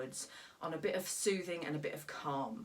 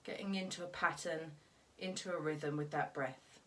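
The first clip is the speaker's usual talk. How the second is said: guided meditation. Acted. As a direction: calmly lead them through each breath, leaving room to breathe. Leads a breathing exercise.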